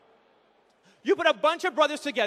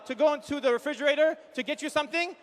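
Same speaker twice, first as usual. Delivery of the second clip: usual speaking voice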